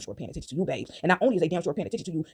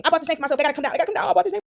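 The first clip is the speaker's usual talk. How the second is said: building higher, louder indignant yell; mock rising outrage; 9 semitones higher, 4 dB louder, faster than usual. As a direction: climb into a squeaky indignant shout. Voicing escalating outrage.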